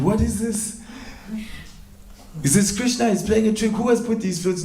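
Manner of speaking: high-pitched, puzzled questioning; baffled disbelief. Acted. Woman finds flutes on her bed, baffled and high.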